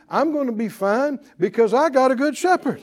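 loud, high preaching build; full-force preaching. Acted. Preacher building to the congregation, loud and high.

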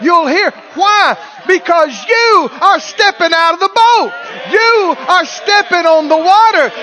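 high, loud shouted exhortation; full-force preaching. Real, not acted. Preacher urging the congregation to step out, full force. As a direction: drive each phrase home at a shout.

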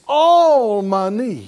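loud, slow, stretched-out proclamation; out-loud declaration. Real, not acted. Preacher leads everybody in affirming provision, out-loud declaration. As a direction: belt it out, drawing out ALL. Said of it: "everybody say it out loud".

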